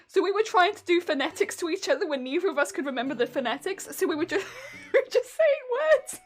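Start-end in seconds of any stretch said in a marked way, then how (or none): 4.9-6.2 high laughing squeak; words squeezed out through laughter; real laughter, voice pitched up. Real, not acted. Breaks into laughter mid story.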